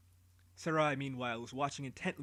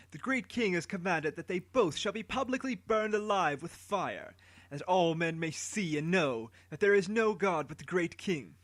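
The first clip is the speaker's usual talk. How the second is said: higher, louder public proclamation; herald condemning; 4 semitones higher, 4 dB louder than usual. Acted. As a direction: rouse the crowd against the accused. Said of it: "Anuki continued".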